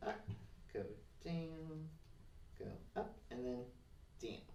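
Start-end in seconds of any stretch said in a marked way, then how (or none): none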